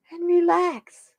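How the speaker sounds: high, slow soothing relax; drawn-out relax. Shown as a demonstration. Soothing the cat while reading the card, slow and drawn out. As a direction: stretch it out to calm her. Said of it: "reading card in calm voice".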